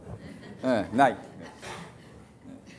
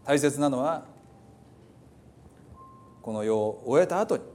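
prayer; 2 semitones lower, 4 dB quieter than usual. Acted. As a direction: pray quietly over the congregation. Leads a closing prayer. (静かに祈る牧師の声)

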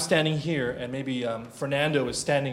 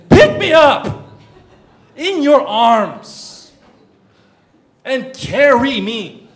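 loud, high, stretched-out plea; crying out; 10 semitones higher, 14 dB louder, much slower than usual. Retold or imitated. Son pleads to be picked up by father, crying out. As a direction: shout it slowly like a child begging. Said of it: "he's crying out".

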